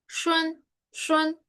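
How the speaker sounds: "Shun" said high with the U N sounding like wen; pron demo. Shown as a demonstration. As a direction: glide "shun" through a W into "en". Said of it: "tiende a pronunciarse como wen".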